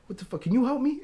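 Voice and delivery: high, indignant mock New Yorker; heavy New York accent. Angry mother demands help from waiting patient, New York accent. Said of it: "she had a really heavy New York accent".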